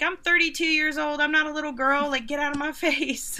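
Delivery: retold annoyed snap; indignant retort. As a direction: fire back at being called a girl. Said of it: "And I'm like".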